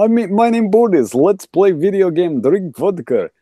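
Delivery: high, hammed-up Russian accent; mock Russian accent. Shown as a demonstration. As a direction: lay on a cartoon Russian accent. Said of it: "if I was doing a Russian voice".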